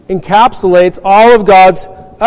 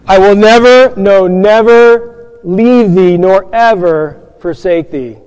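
NEVER stressed hard, then slowed on no, never; emphatic promise; slower than usual. Shown as a demonstration. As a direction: lean on each never, slow down. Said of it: "the construction is very emphatic".

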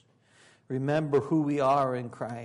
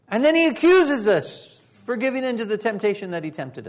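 full-force preaching; 9 semitones higher, 9 dB louder than usual. Acted. Preacher warning the congregation, loud and high. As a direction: preach it out to the whole room.

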